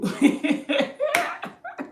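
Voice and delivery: high-pitched giggling fit; high helpless laugh. Real, not acted. Laughing at men who promise marriage, helpless laugh. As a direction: crack up laughing, high and uncontrolled. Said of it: "I'm laughing".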